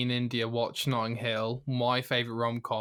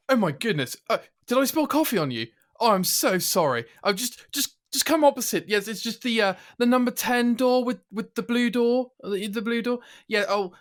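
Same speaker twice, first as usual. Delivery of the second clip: higher, flustered posh British rom-com voice; Hugh Grant impression; 8 semitones higher, 3 dB louder than usual. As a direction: apologise like a bumbling posh Englishman, pitched up. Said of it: "I'm gonna do Hugh Grant from the film".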